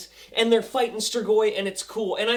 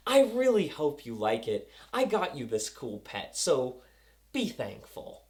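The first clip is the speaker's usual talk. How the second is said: lightly voiced villain, lower; slightly menacing, sinking low and soft; imitación de Eichhorst; 6 semitones lower, 4 dB quieter than usual. Voices Eichhorst giving a pet.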